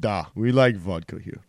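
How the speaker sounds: put-on a light Russian accent. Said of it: "That's one of my best ones".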